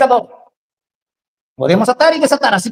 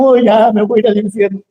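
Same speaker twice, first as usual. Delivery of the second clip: mock wounded whine; 3 semitones lower than usual. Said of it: "se te ven mal los pantalones".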